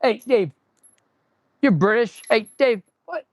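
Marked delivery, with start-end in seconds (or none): none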